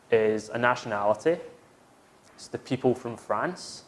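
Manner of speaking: usual speaking voice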